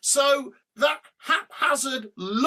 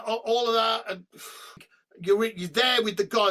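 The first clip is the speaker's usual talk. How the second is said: ordinary delivery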